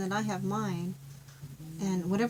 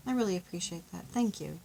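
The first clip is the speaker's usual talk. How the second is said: soft, gentle thank-yous to a partner; tender thanks; 5 dB quieter than usual. Shown as a demonstration. Thanking a partner for little things, soft and sincere. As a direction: thank them softly and sincerely. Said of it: "you say".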